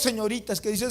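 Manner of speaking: usual speaking voice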